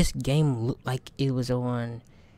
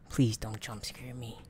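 scared whisper; 4 semitones lower, 11 dB quieter than usual. Real, not acted. Horror game, hiding from monster.

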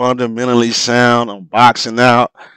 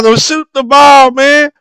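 urgent plea; 6 dB louder than usual. Real, not acted. Yelling at Jordan on the replay.

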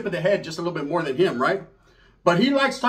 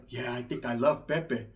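lower, softer, slow dreamy voice; 7 semitones lower, 8 dB quieter, slower than usual. Retold or imitated. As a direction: confess it shyly. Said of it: "She's like".

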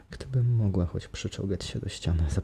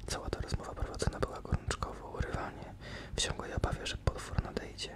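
hushed storytelling whisper; whispered narration; 8 dB quieter than usual. A narrator's reading. Narrator telling the captives' plight in a whisper. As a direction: breathe the tale in a tense hush.

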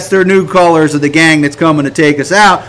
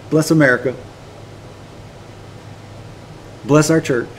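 low, soft token prayer; perfunctory prayer; 4 semitones lower, 10 dB quieter than usual. Acted. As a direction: toss off a routine blessing, low and quiet. Half-hearted routine prayer voiced.

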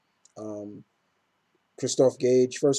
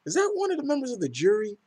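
surprised question; 12 semitones higher, faster than usual. Retold or imitated. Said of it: "And I'm like".

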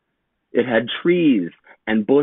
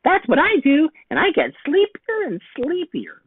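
little sister. A narrator's reading. Little sister answers Jake in a tiny high voice.

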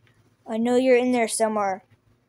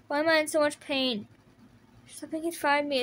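usual speaking voice

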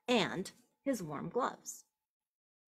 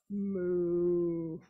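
low drawn moo; cow moo; 3 semitones lower than usual. Acted. Narrator mooing for the cow. Animal noises in counting story.

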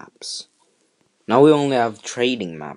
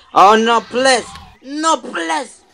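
anguished denial; 13 semitones higher, 6 dB louder, slower than usual. Real, not acted. Dinosaur attacked in game.

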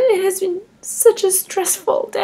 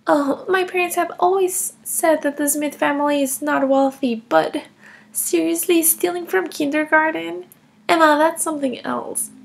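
usual speaking voice

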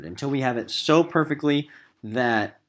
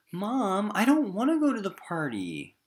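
put-on pleading child; 11 semitones higher than usual. Nervous boy pleads with mom.

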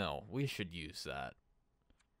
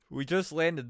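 talking through a yawn; words stretched by a yawn; 8 semitones higher, 10 dB louder than usual. Real, not acted. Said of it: "Sorry, I'm yawning".

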